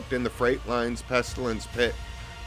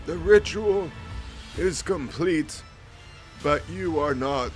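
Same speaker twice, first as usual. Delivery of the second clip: higher, grave priestly voice; solemn vizier; 6 semitones higher than usual. Acted. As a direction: speak as an ancient cult priest. Vizier answers.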